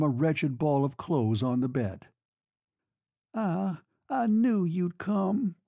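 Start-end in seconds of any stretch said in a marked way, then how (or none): 3.3-5.6 high, very slow, drawn-out broken voice; broken murmur. A narrator's reading. Woman in bed greeting the lover who came, broken murmur. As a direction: murmur it brokenly, stretching each word. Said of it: "she murmured brokenly".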